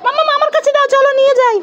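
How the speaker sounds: put-on high child-character voice; child character, eager. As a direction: show mother a find as a little one. Child character asks mother. (বাচ্চার সরু গলায় মাকে দেখানো)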